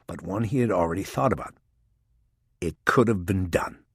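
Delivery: ordinary delivery, nothing marked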